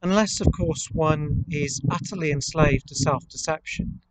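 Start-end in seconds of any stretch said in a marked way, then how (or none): none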